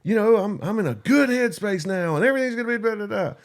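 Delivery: high, hyped-up YouTuber pitch; mocking his own promise. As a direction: parody his upbeat video self. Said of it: "you promised these motherfuckers".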